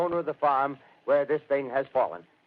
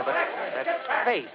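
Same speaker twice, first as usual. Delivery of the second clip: horrified; 8 semitones higher, much slower than usual. Acted. Reporter horrified by the face.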